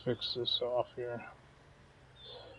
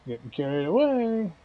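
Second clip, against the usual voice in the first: higher, playful sing-song; sing-song repeat; 6 semitones higher than usual. Shown as a demonstration. Laughing at his own slip, higher sing-song repeat. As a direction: toss it back up high, teasing yourself.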